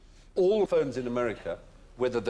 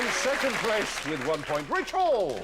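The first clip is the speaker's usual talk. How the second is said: game show host; 9 semitones higher, 3 dB louder than usual. Acted. Announces the final scores.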